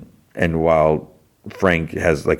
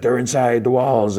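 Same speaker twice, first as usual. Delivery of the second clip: mock yell; 6 semitones higher than usual. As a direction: imitate a man yelling, held back in volume. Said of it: "like, yelling".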